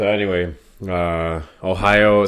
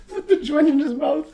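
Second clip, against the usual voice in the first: ordinary talk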